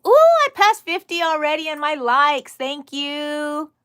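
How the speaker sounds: loud, high and thrilled; squealing with joy over likes; excited. Real, not acted. Excited about passing fifty likes.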